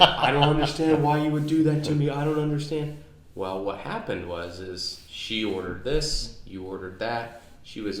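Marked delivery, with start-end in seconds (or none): 0.0-3.0 put-on high, loud whiny kid voice, half laughing; hammed-up wailing little boy; imitating upset son. Dad mimics his son losing it over macaroni, high whiny wail. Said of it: "he started to lose it".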